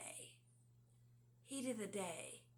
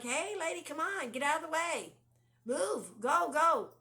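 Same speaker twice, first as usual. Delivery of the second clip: impatient yelling; 8 semitones higher, 11 dB louder than usual. Retold or imitated. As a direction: yell from the car to hurry her up. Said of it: "You're like".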